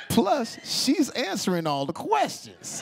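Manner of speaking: high, excited comic plea; urgent urging. Retold or imitated. Host urging the boyfriend to propose, excited pleading. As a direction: press him hard, pitch jumping up.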